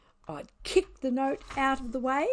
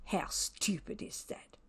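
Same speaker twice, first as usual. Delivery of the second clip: whispered scorn; 8 dB quieter than usual. A narrator's reading. Scornful aside.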